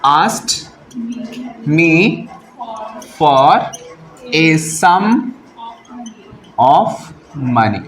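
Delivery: slow dictation, one word at a time. Shown as a demonstration. Teacher dictates the corrected sentence to students, word by word. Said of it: "कैसे?".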